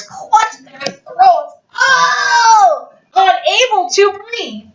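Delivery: high loud choking cry; dramatic cry. A narrator's reading. Narrating burglars gassed, high loud choking cries. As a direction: gasp it out as if choking.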